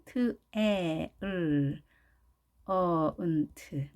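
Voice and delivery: talent split into "트", "에", "을", "어", "은", "트"; slow separated phonemes, sinking in pitch; sound-by-sound demo. Shown as a demonstration. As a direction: isolate each sound, holding "에" and "을". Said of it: "발음은 어떻게 하는지".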